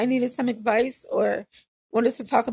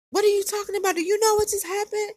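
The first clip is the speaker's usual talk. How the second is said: pitched way up, mock yelling friend; imitating her yelling; 9 semitones higher, faster than usual. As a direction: play the frantic friend shouting in her ear. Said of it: "she was in my ear screaming".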